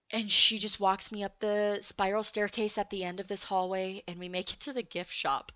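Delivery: ordinary delivery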